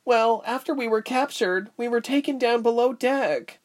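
lightly voiced scared girl, high pitch; a touch of fear, high voice; shivering girl. A narrator's reading. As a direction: recount it anxiously, pitch way up. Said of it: "one girl shivered".

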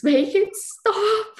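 high, loud, drawn-out wail; retold scream. Mother retelling her labour to a friend, high screamed plea. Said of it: "and just screaming".

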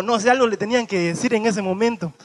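ordinary talk

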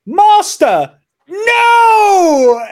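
high loud goofy yell. Retold or imitated. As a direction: ham up a bad voice actor shouting. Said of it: "this goofy fucking voice actor".

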